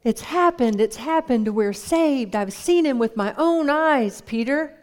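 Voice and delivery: breathless, high and loud with joy; excited. Acted. Andrew rushing to Peter with news, excited and loud. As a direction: burst in with the good news. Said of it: "and he says".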